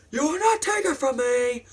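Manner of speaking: high-pitched theatrical yell; hammed-up possessive fury; acted angry defiance. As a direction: refuse in a strained, high cry. Acts out Anakin's defiance.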